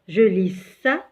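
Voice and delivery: ordinary delivery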